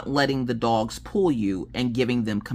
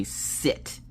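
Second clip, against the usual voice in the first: firm; 6 dB quieter than usual. Retold or imitated. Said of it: "Be firm and say".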